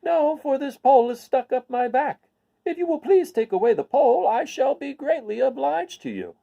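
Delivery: high scarecrow voice asking for help; scarecrow pleading. Acted. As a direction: ask politely in the high scarecrow voice.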